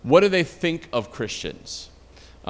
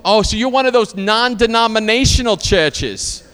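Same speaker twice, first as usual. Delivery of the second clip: higher-pitched Boston aunt impression; Boston-accented, raised-pitch knowing retort; 6 semitones higher, 4 dB louder than usual. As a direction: imitate a Boston aunt pigeonholing someone. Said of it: "she's like, she's from Boston".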